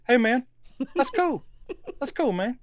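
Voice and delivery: put-on easy "hey man" of an old folk musician; father's Southern voice. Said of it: "'cause he still says".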